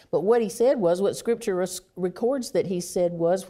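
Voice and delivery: usual speaking voice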